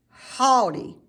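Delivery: plain reading voice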